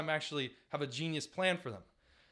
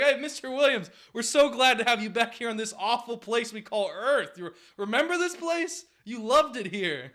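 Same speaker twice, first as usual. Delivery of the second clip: mock staff greeting the thawed man; cheery welcome; 9 semitones higher, 8 dB louder than usual. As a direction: fake-friendly welcome back to Earth. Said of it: "They're like".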